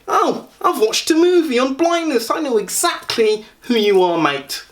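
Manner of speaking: high-pitched Essex know-it-all caricature; mock Essex accent. Stranger who saw a film on blindness, mock Essex accent. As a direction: put on a squeaky, cocky Essex voice. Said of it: "I'm doing that accent".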